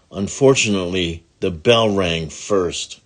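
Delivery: usual speaking voice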